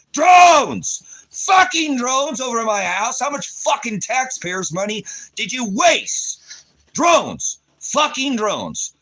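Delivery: real enraged yelling; furious shout. Real, not acted. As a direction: bellow it out in outrage. Furious rant about drones overhead.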